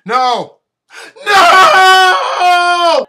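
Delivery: rising from loud no to a cry; building shout of shock. Real, not acted. Shocked by celebrity news.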